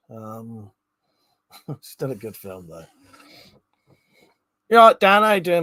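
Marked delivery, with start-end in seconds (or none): 4.7-5.6 loud greeting. Real, not acted. Greets a viewer in chat.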